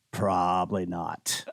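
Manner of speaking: very low, slow, quiet dismissal; deadpan doubt. Retold or imitated. Friend answering his buddies about Dale's horse knowledge, deadpan. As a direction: drop low and draw out the doubt.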